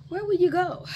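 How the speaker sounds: pitched well up, a startled mother asking; mother's high surprised question. Acted.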